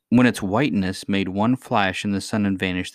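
ordinary delivery, nothing marked

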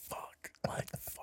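breathy panicked whisper. Retold or imitated. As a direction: whisper it in horror. Panicked thought while she smelt it.

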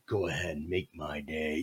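very low, quiet threat; imitated steely cop daring someone; Dirty Harry. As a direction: drop into a soft movie tough-guy voice. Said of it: "turns into Clint Eastwood, Dir-, Dirty Harry".

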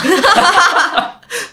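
big high-pitched burst of laughter; loud high laugh. Real, not acted. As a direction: crack up loudly at the joke.

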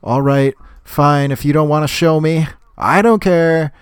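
fed-up cranky neighbor; annoyed Squidward. Retold or imitated. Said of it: "He's like".

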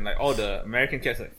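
ordinary talk, nothing marked

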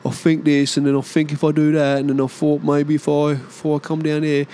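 put-on English accent saying think as fink, F instead of th; mock a bloke who says fink for think. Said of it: "dudes who say Fs instead of th".

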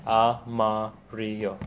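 slow, careful Amarillo. Real, not acted. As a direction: Amarillo drawn out slowly. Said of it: "You always say it so quickly".

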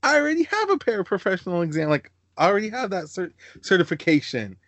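high, eager mock voice of an overeager woman. Mocking the girl who jumps at the job: high, eager. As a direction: play up a keen applicant, pitched high. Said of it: "she's like".

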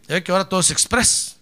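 ordinary talk

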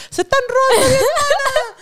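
high shrill sister's yell; mock yell. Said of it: "Y la hermana gritando". (grito agudo de la hermana)

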